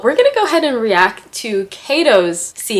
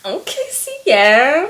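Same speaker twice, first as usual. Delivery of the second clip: slow, higher drawn-out okay; impressed; 6 semitones higher, slower than usual. Real, not acted. Reacting to a bold romantic line, impressed. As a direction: savor the name, impressed.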